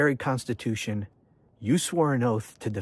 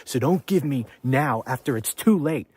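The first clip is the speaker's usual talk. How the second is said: ordinary talk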